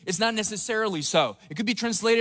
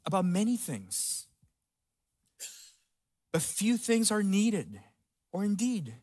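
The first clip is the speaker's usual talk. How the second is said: tender Jesus; 4 semitones lower, 7 dB quieter than usual. Acted. Jesus answering Martha tenderly.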